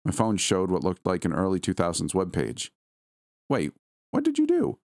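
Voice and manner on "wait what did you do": sudden high shocked question; surprised. Acted. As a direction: blurt it out in disbelief. Shocked that link now works.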